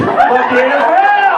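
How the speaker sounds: slow, drawn-out laughing curse; real laugh through the swear; laughing exclaim. Real, not acted. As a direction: stretch it out while laughing. Reacting to crowd laughing.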